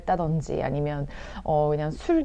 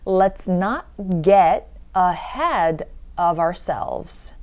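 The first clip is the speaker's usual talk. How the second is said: very slow, word by word; slow demo; much slower than usual. Shown as a demonstration. Teacher going through the sentence slowly, slow demo. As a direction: stretch out each word for learners to follow. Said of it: "천천히 한번 볼게요".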